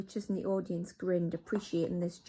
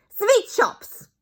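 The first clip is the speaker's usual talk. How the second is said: put-on much higher, much louder accented shout; 13 dB louder than usual. Grand High Witch answers her witches, loud shout. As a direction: announce it triumphantly. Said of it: "shouted the Grand High Witch".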